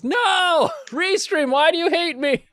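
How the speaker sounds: high, loud cry of mock despair; exasperated shout. Real, not acted. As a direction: yell it at the software, half laughing. Audio fails, host cries out.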